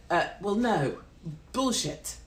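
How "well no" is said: ordinary delivery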